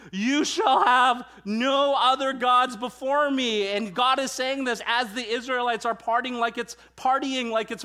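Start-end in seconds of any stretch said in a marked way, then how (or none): 0.1-3.7 louder, higher, slow full shout; second take, cranked up to a shout; shouted command. Shown as a demonstration. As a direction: bellow it like the voice from Sinai.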